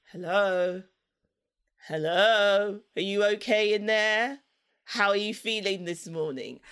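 lightly voiced careful mother; coaxing tender mum. Mum coaxing child in morning.

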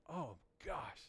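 put-on a young convert sneering at men crying; disgusted aside. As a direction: scoff at it quietly. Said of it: "I'm like".

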